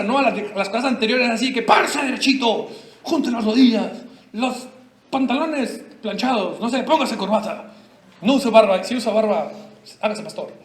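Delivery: ordinary delivery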